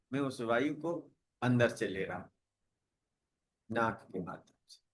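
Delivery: soft, unhurried meditation voice with long pauses; guided meditation. Real, not acted. Yoga teacher guiding students to watch the breath, calm meditation voice. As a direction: guide them gently into relaxation, phrase by phrase.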